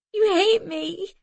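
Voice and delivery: high, slow, frightened; a child dreading rejection; terrified girl. A narrator's reading. Girl fearfully accusing her father. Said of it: "terror in her eyes".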